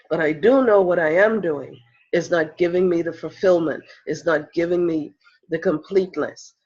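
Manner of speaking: ordinary talk, nothing marked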